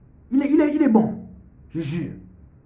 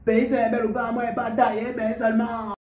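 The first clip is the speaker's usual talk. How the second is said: mock Ivorian rap accent, higher; 8 semitones higher than usual. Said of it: "regardez comment je vous sors l'accent ivoirien". (accent ivoirien rappé, plus aigu)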